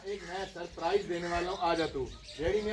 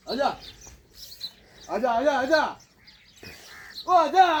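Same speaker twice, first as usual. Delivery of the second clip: higher and louder, building to a yell; loud calling; 8 semitones higher, 5 dB louder than usual. Real, not acted. Farmer calling stubborn buffalo, rising loud call. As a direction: call out, then yell the last one.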